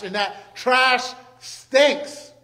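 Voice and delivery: drawn-out, slow disgust on stinks. Acted. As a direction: stretch every word in revulsion. Stretching out disgust at trash.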